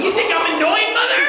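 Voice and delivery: high mock-hurt question, rising at the end; hammed-up wounded indignation; offended kid. Acts out offended child questioning mom.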